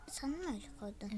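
hushed fast question; whispering. Real, not acted. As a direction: whisper it quickly under breath. Quiet question during game. (fısıltıyla)

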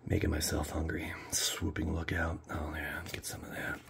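hushed, breathy card whisper. Real, not acted. Whispering to himself while flipping cards, hushed. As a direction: murmur to himself, barely voiced.

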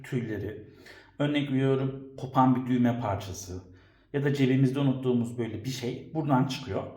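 ordinary talk

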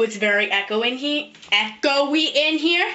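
usual speaking voice

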